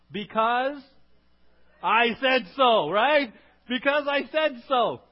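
loud, slow, high fed-up parent; exasperated parent. Retold or imitated. Parent ending the argument, loud exasperated retort. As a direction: put your foot down at last.

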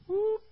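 sudden high yelp of alarm; mock startled whoop. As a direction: squeak like the room flinching. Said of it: "Everyone just goes".

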